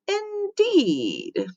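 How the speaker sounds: drawn-out high, soft indeed; skeptical. Acted. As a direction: let the doubt show. Janitor doubts the girl.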